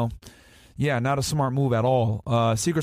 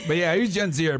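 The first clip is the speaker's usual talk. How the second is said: ordinary talk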